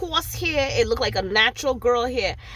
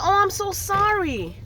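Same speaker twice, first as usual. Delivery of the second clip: mock waitress apology; 8 semitones higher than usual.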